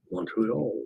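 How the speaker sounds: tentative, soft attempt at a French accent. Shown as a demonstration. Host trying Montreal in French, failed French accent. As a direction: try a French accent, quietly. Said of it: "say Montreal with a French accent".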